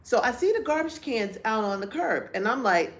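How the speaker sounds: ordinary talk, nothing marked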